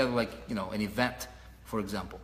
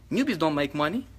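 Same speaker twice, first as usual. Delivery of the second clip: ordinary talk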